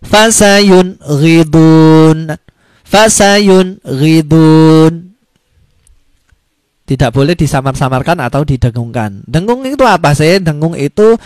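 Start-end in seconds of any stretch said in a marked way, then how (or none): 0.0-5.1 very slow, louder fasayun ghidun, n crisp before gh; clear izhar. Shown as a demonstration. Teacher demonstrating the reading, slow and emphatic. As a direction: draw out fasayun ghidun firmly.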